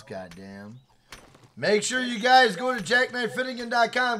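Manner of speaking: usual speaking voice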